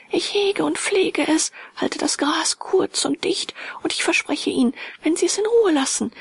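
put-on high, pleading child's cry; a little boy begging, voice up high; child pleading with the stranger, high and tearful; sobbing child. A narrator's reading. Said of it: "rief er schluchzend".

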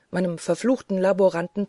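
ordinary delivery, nothing marked

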